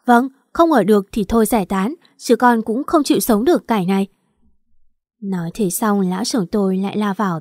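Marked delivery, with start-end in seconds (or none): none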